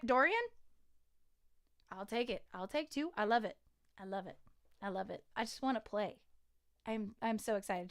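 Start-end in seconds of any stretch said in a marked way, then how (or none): none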